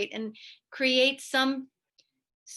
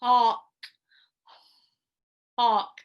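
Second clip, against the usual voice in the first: "Hawk" pushed out loud with a hollow open throat; pause, open wide, then hawk; loud hawk sound; 7 dB louder than usual. Shown as a demonstration. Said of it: "I wait and I try to open".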